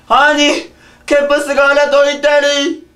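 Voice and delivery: high loud disbelief; shocked. Acted. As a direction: gasp at the news. Shocked exclamation.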